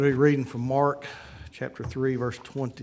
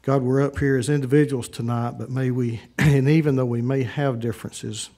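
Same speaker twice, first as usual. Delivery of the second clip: loud, earnest public prayer; 10 dB louder than usual. Acted. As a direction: lead the room in prayer. Leads invocation prayer.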